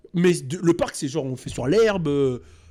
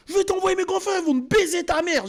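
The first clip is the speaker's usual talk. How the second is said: hammed-up enraged girlfriend yelling; furious yelling girlfriend; 11 semitones higher than usual. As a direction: shriek it at him like a jilted girlfriend. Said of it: "Elle criait".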